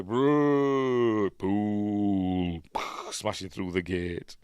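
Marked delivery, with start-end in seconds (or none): 0.0-2.6 drawn-out mock zombie groan; zombie impression. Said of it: "like zombies, aren't they?".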